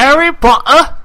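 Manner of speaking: high, loud put-on British impression; Harry Potter. Streamer redoing his mocked impersonation for friends, high and loud. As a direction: do a bad, high-pitched boy wizard impersonation. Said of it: "you did your Harry Potter impersonation".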